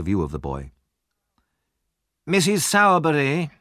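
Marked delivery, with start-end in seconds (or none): 2.3-3.5 calling his wife from the shop. A narrator's reading. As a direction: call out to the back room. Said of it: "he called".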